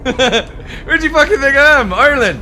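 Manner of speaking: loud, high hammed-up Irish accent; playing an angry Irishman for laughs; mock Irish accent. Reacting to Riverdance music in chat, loud mock Irish accent. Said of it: "I love the Irish accent".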